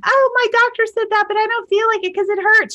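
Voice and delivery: hammed-up whiny mom; mom brushing off advice. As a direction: mimic a dismissive mother. Voicing mom dismissing advice.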